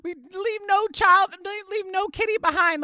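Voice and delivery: lightly put-on upset-daughter voice; upset child. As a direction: imitate a child's tearful plea. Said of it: "She starts crying. She's like".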